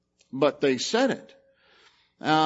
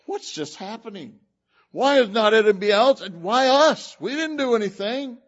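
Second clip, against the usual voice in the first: high, rising panic; mock-frantic, why us; panicked brothers; 6 semitones higher, 4 dB louder than usual. The brothers confused before Joseph, a hammed-up panicked protest.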